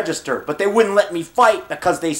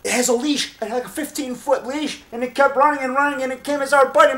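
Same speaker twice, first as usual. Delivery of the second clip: much higher, rising more and more as it goes; agitated retelling; 8 semitones higher than usual. Real, not acted. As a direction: relive the shock of the attack. Recalling dog attack, agitated.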